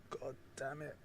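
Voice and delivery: sighing, whispered "God damn it"; a real, defeated whisper; whispered curse. Real, not acted. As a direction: breathe it out under your breath in disgust. Sighs at wrong review.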